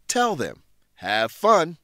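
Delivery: normal talking voice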